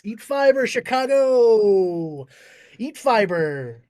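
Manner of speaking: loud, long-drawn crowd farewell; mock showman's closing yell; shouted sign-off. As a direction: holler it out, stretching the last word.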